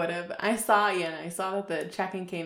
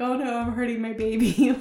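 mock panic; 6 semitones higher, 9 dB louder than usual. Retold panic, louder.

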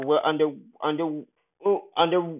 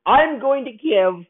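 voice cracking; 9 semitones higher, 5 dB louder, slower than usual. Real, not acted. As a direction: voice cracks up high on the words. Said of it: "my voice just cracked".

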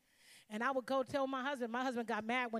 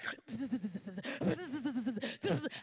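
buzzing fly noise. Retold or imitated. Imitated fly buzz.

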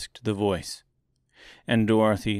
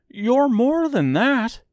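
high, loud, slow scarecrow grievance; grieved tone; 5 dB louder, slower than usual. A narrator's reading. Scarecrow accusing the false wizard, grieved tone. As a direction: complain high and slowly, aggrieved. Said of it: "said the Scarecrow in a grieved tone".

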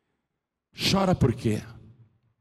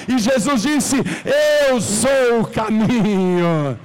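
high, loud preacher's cry; fervent preaching; 10 semitones higher, 5 dB louder than usual. Acted. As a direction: preach it out to the whole church. Preacher building to climax. (pregação inflamada em voz alta)

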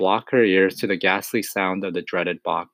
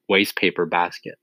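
usual speaking voice

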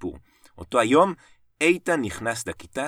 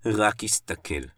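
ordinary delivery, nothing marked

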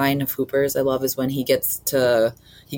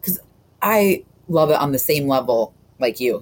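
ordinary talk, nothing marked

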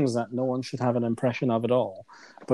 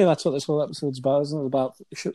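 ordinary talk, nothing marked

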